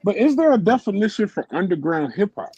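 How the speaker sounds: high, pressing question; insistent question. Real, not acted.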